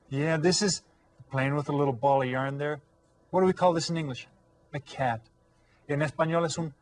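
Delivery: normal talking voice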